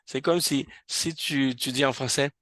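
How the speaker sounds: usual speaking voice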